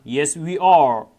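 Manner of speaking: drawn out word by word; very slow model reading. Shown as a demonstration. Teacher modelling the answer for students, very slow. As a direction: stretch each word for learners. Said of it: "teacher models textbook answer".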